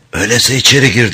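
quick, grating rasp. Acted. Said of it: "dedi hırıltılı ses".

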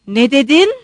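loud challenge with a sharp rise; roared question. A narrator's reading. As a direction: demand it loudly, as if roaring. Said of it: "diye kükredi".

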